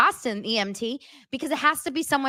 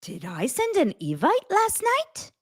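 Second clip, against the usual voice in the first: mock puzzlement; 8 semitones higher than usual. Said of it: "You wouldn't be like".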